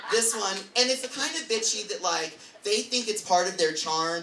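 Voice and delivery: ordinary talk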